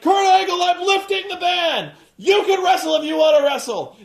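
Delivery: high, loud wrestling promo yell; Flair shouting. Retold or imitated. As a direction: bellow the news at the ring. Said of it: "he's like".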